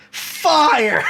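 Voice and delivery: high, loud, laughing "Fire!"; laughing punchline. Pretend. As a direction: blurt "Fire!" through a laugh. Punchline of the fake text.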